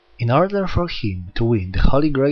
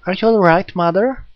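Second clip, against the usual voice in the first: put-on a small girl fretting over her mother; childlike girl voice; 8 semitones higher, 5 dB louder than usual. Jack kneeling by Reika, worried child voice.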